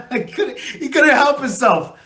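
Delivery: can't help breaking into laughter; laughing. Real, not acted. Fan recalling Kelce's speech on his vlog, laughing. Laughing while telling a funny moment.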